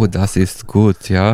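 slow, mock German accent; playful German accent among friends. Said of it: "do, like, a German accent".

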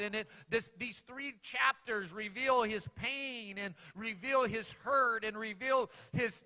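loud, high preaching cadence; fervent sermon delivery. Acted. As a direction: preach it out to the congregation. Preaching at full force.

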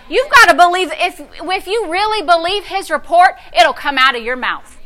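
ordinary talk

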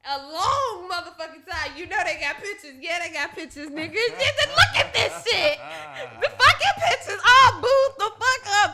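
shouting through big laughter, pitched high; real hysterical laughter, loud and high; loud laughing. Real, not acted. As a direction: crack up while yelling about the photos. Scrolling couple's photos, cracking up.

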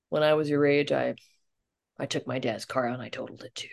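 low, hushed officer voice; confiding policeman. Retold or imitated. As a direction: imitate a cop softly owning up. Policeman confiding own teenage crash.

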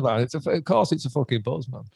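ordinary delivery

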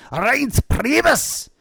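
high, drawn-out mock Nazi shout. Co-host mocking the RNC chair's name, Nazi-style shout. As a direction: bellow the name like a cartoon Nazi officer. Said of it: "he sounds like a fucking Nazi".

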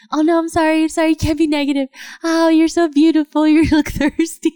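high, gushing voice doting on a plant; dramatic plant talk. Retold or imitated. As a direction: coo over the plant like a drama queen. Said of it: "she's so dramatic".

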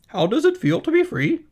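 high, loud, fast goose chatter; quick, loud honking goose voice; fast-talking goose. Acted. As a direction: rattle it off like a goose, repeating words. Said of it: "she asked".

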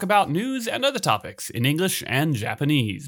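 upbeat podcast host intro; announcer voice. Acted. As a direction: announce the show brightly. Reads the show opening.

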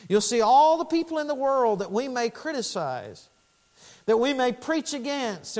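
high-pitched pulpit fervour; impassioned preaching. Real, not acted. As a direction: preach it in long, urgent, rolling phrases. Preaches at full force.

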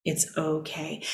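very soft, slow, gentle reassurance; calm a scared inner voice softly; soothing it's okay. Retold or imitated. Talking back to her anxious inner voice, hushed and tender. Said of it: "I'm saying".

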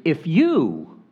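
"if you" lifted and pressed; stress on if you. Shown as a demonstration. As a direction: lean into "if you" to show the emphasis. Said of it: "that's emphatic in the Greek".

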